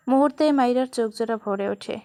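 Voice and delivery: usual speaking voice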